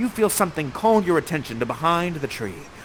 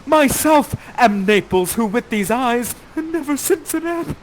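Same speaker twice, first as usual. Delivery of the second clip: much higher, louder, slow and theatrical; hammed-up rapture; 13 semitones higher, 11 dB louder, slower than usual. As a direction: overact his joy and grief. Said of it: "You say".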